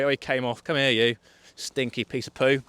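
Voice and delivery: usual speaking voice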